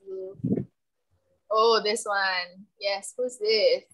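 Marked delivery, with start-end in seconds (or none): none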